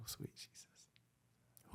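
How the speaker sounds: whispered lead-in. Real, not acted. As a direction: drop to a breath before the quote. Whispered lead-in before imitation.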